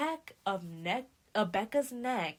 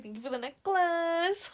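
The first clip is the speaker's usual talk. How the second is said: very high, sing-song imitation; mock sweet thanks; 9 semitones higher, 4 dB louder than usual. Lily to the camera after winning, showy mock thanks. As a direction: mimic showy thanks, climbing high at the end.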